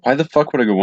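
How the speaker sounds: ordinary delivery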